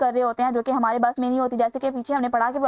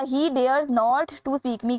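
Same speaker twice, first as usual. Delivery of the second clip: reading the sentence very slowly; much slower than usual. Shown as a demonstration. Teacher reading a quiz sentence to students, very slow. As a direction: spell out every word of the item.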